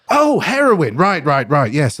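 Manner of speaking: high, loud "oh" of understanding; acted polite recognition, tumbling into agreement; mock sudden realisation. Acting out finally understanding the offer.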